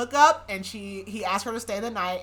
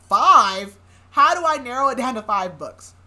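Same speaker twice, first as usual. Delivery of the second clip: loud disbelief at the request; shocked, louder, how could anyone choose; incredulous outburst; 4 semitones higher, 11 dB louder than usual. Retold or imitated. Marcella to Theo when asked for only five books, loud disbelief.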